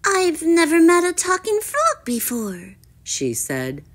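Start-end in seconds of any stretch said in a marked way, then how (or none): 0.0-2.8 high, astonished princess voice; high-pitched amazement; surprised princess. A narrator's reading. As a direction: marvel at it, pitched way up. Princess surprised by talking frog.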